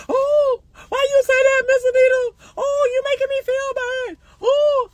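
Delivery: hammed-up hurt crying voice; mock whining. Mocking listeners who can't take the truth, whiny fake crying.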